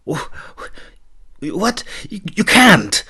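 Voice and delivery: high, stumbling, loud protest; stammering denial. A narrator's reading. Nathia stammering in denial at the banishment. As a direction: stammer it out in shock. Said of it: "Nathia stammered in denial".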